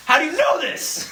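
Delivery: high astonished question; amazed disbelief. Retold or imitated. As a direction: marvel in disbelief. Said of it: "it's like".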